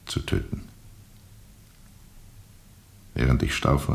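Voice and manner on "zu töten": whispered. Acted. Urge to kill, whispered.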